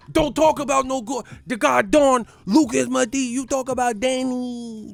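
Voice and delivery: loud, high-pitched hype rant; Angel going hyphy. Acted. Imitating Angel defending Danny from the haters, hyphy high-pitched holler. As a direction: holler it high, riding every phrase. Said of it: "I like when Angel go hyphy".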